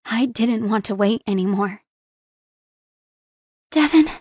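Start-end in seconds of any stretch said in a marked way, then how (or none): 3.7-4.1 high pleading whimper, louder. Acted. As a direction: whimper his name, aching. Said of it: "I whimpered".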